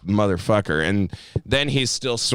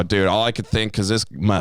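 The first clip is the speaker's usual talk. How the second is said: normal talking voice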